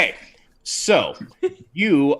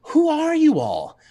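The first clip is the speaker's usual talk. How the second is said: party lady; 14 semitones higher, 4 dB louder than usual. Acted. Party-dressed lady asking the heroes, high and prissy. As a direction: ask it like a fussy lady. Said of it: "and she says".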